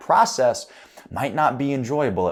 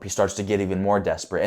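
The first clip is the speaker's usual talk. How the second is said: ordinary talk, nothing marked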